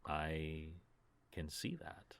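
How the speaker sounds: soft, low tender words; gently sad, barely spoken; quiet tender. Acted. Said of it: "and she says".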